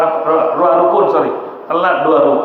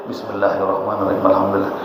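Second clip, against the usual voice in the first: rushed recitation; 9 semitones lower, 5 dB quieter, faster than usual. Shown as a demonstration. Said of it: "quick recitation".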